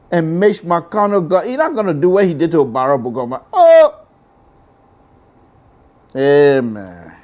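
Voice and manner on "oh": high loud sudden ah; sharp shocked cry. Real, not acted. Host realising the stakes, high loud shocked cry. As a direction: yelp in dismay. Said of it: "shocked cry".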